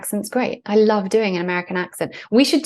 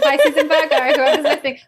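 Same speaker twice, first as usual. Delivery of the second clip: laughing aloud; 7 semitones higher, 3 dB louder than usual. Real, not acted. Laughing at going off on a tangent.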